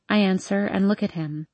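ordinary delivery, nothing marked

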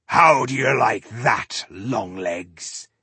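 slow gruff taunting growl; dwarf growl. Acted. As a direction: sneer it at the beast. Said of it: "he growled".

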